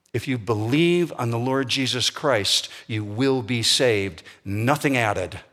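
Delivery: usual speaking voice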